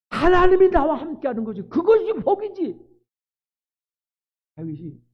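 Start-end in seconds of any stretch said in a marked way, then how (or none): 0.1-2.8 high, loud preacher's cry; fervent preaching. Acted. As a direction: preach it out with full force. Preaching at full force.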